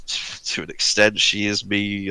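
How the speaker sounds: ordinary delivery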